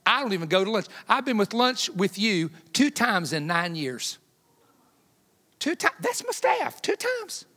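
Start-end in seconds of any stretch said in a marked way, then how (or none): 5.6-7.4 very high-pitched disbelieving echo; mock incredulous squeak. Mock astonished echo about his lunches.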